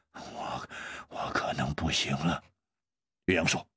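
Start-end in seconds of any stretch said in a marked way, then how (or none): 0.1-2.4 low, faint, halting murmur; small weak voice. A narrator's reading. Zhao tells Yue Yang he can't make it, quiet weak voice. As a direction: murmur it feebly, as if giving up. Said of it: "小声地说".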